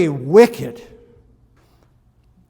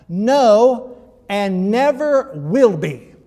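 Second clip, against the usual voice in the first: NEVER in "and never will be" stressed and drawn out slowly; emphatic never; 3 semitones higher, 4 dB louder, much slower than usual. Acted. Reading Jesus' words to the class, emphatic on never. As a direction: lean hard on "never", slow and solemn. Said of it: "he's speaking very emphatically here".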